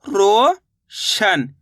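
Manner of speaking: Roshan drawn out very slowly, syllable by syllable; teacher slowly sounding out Roshan; stretched out slowly. Shown as a demonstration. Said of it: "शन बोलेंगे तो शा जाएगा".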